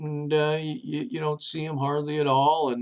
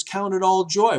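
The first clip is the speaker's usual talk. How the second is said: proclaiming; 6 semitones higher, 5 dB louder than usual. Retold or imitated. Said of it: "he says".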